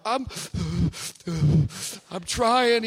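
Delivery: put-on gasping between words like a sobbing kid; mock crying. Son answering dad mid-cry, mock gasping sobs. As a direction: imitate a child catching his breath through sobs. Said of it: "the kind of crying, it's like you don't know if you got asthma".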